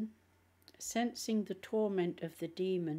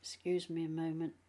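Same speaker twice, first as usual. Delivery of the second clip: speaking with a cold, coughing; has a cold; faster than usual. Real, not acted. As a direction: sound stuffed up from a cold. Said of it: "I've still got this cold".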